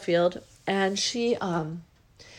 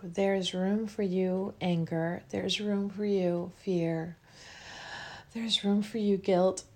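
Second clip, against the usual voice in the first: guided affirmation; 3 semitones lower, slower than usual. Acted. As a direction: let each word land gently, slowly. Leads a guided emotional release.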